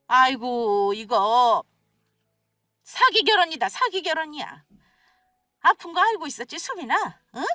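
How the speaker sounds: indignant accusations, often pitched high; agitated mother-in-law. Acted. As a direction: accuse her of hiding the truth. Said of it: "격앙된 말투로 화를 내시기". (격앙되어 몰아붙이는 시어머니)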